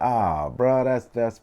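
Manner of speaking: high, loud, overwhelmed gratitude; retold touched reaction. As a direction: re-enact being bowled over by a friend's gesture. Retelling his moved reaction to birthday gift.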